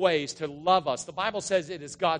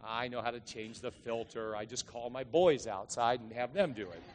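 much softer, lower confessional aside; sheepish aside; 7 semitones lower, 8 dB quieter than usual. Real, not acted. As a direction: let the congregation in on it. Joking correction, sheepish aside.

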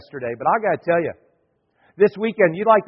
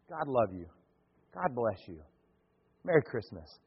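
tender blessing; 7 semitones lower, 11 dB quieter than usual. Retold or imitated. Embracing a hardened visitor tenderly.

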